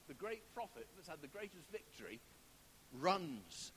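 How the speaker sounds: ordinary talk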